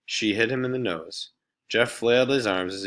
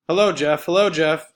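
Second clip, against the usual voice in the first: higher, louder singsong taunt; 8 semitones higher, 7 dB louder than usual. Acted. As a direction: needle him with greetings. Laurie taunting Jeff.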